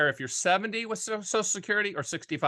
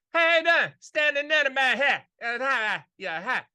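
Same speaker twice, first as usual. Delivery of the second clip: much higher and louder, a rambling mumbly uncle with a chuckle; Uncle TW impression; 7 semitones higher, 4 dB louder than usual. Uncle calling to ask what to do with the money, loud high ramble. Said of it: "my Uncle T W used to talk like that".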